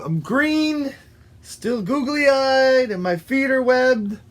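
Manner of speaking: high, drawn-out self-inspection; high-pitched character listing; dismayed frog. Acted. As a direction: take stock of yourself with growing doubt. Frog checks himself for change.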